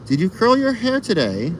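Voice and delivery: a high, lightly voiced question; Dave's question. Reading Old School Dave's question, high light voice. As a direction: read the chat question in a bright high voice. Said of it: "asks old two Dave".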